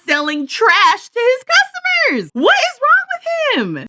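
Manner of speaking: high-pitched, exasperated disbelief; appalled, stretching words for emphasis; incredulous outrage. Real, not acted. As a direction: rant in scandalized amazement, pitch way up. Outraged reaction to the cartoon's plot.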